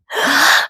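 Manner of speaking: deep breath drawn in; big gasp. Acted. Goose filling up before the breath contest, deep gasp. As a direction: suck in a big breath to hold. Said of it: "took a big breath".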